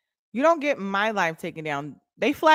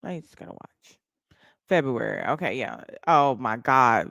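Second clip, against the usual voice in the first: ordinary delivery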